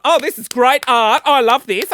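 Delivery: loud, sarcastic gushing over the art; mock enthusiasm. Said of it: "And I'm like".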